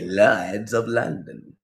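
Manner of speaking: slow mock British accent; British accent slipping out. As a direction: draw it out in imitation British. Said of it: "his Southern accent kinda disappeared".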